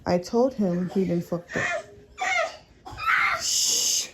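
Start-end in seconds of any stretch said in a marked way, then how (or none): 3.4-4.1 shushing. Real, not acted. Mother shushing her kids during testimony, sharp shush.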